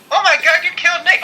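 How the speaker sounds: high-pitched fake gasp of shock; hammed-up disbelief; mock shock. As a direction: play up the horror for a laugh. Joking that Nate died.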